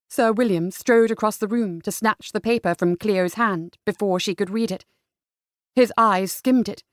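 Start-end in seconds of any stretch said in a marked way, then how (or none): none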